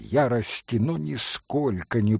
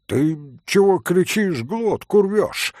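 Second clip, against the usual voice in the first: higher-pitched rude challenge; 6 semitones higher, 4 dB louder than usual. A narrator's reading. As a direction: snap back rudely, rising at the end. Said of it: "с какою-то непонятною даже невежливостью". (грубый, невежливый окрик)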